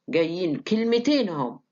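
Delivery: ordinary delivery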